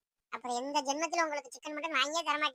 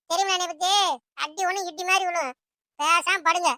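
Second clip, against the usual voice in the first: loud, forceful telling-off; scolding mother; 3 semitones higher, 7 dB louder than usual. Acted. Mother refuses meat and threatens the children, scolding. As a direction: lay down the law loudly.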